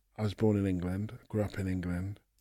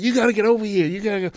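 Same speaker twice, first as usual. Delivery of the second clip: eager urging; 10 semitones higher, 4 dB louder, faster than usual. Retold or imitated.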